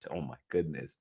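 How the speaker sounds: usual speaking voice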